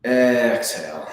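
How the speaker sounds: long slow Exhale, stretched out; slow guiding Exhale; drawn-out exhale cue. Shown as a demonstration. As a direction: draw the word out like a long breath out. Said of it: "cue Exhale".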